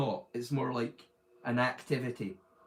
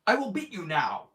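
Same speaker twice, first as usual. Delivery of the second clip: hammed-up American accent of an old kung fu dub; 4 semitones higher than usual. Mocking an old martial arts film's American dub, hammed-up American accent. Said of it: "an American accent going".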